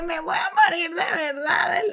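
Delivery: laughing, half-crying wail; mock-tragic crying shout; mock sobbing. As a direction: wail it between laugh and sob. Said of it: "O sea, yo dije".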